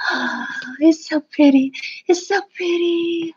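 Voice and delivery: slow, airy gush of admiration; retold awe. Recalling the morning drive to school, gushing in awe. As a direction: gush slowly, drawing out each phrase.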